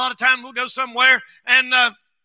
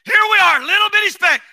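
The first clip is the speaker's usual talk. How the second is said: high, loud preaching cry; preaching shout; 8 semitones higher, 4 dB louder, faster than usual. Real, not acted. Preacher shouting to his church, louder second time. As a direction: lift it up and drive it home. Repeated, even higher preaching.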